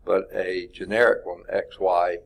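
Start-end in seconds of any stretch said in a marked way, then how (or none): none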